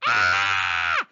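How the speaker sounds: loud rabbit shriek of fright; frightened scream. A narrator's reading. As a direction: shriek in fear. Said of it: "a scream of fright".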